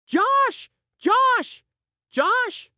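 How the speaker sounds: excited barking. A narrator's reading. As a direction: yelp his name over and over in joy. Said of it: "My tail goes crazy with excitement".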